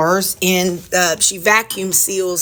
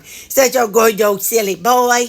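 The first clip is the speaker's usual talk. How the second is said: talking to the dog; 3 semitones higher than usual. Acted. Baby talk to the dog.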